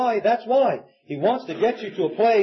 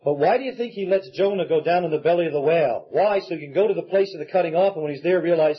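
normal talking voice

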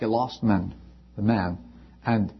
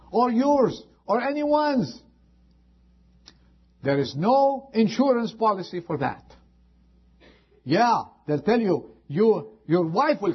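rising, higher fervent sermon voice; fiery preaching; 9 semitones higher than usual. Real, not acted. Preacher warning the congregation of sudden death, fiery. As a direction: climb higher and higher as the warning builds.

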